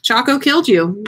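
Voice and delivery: ordinary delivery, nothing marked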